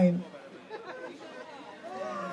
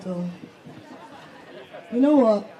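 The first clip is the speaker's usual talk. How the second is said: ordinary delivery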